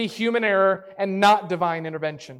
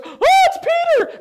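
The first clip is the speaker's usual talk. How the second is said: high, loud squeal of joy; excited squeal; 7 dB louder than usual. Retold or imitated. Servant girl hearing Peter at the door, excited squeal. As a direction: shriek it out, thrilled to hear him. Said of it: "And Rhoda goes".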